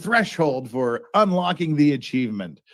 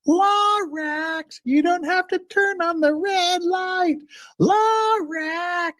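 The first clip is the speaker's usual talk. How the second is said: calling out to a pet; much slower than usual. Real, not acted.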